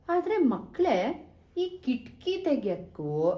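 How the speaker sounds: teasing suspense. A narrator's reading. Teller teases children with suspense.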